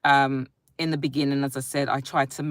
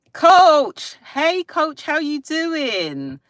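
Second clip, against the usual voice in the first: much higher, drawn-out hello to Coach; high sing-song greeting; 10 semitones higher, 6 dB louder, much slower than usual. Pretend. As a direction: call out to Coach like a cheery host.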